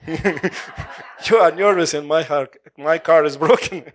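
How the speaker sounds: slow, half-laughing complaint to the nervous driver; a retold reply to a driver, laughing; laughing retort. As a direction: laugh through the words, slow and amused. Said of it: "I said".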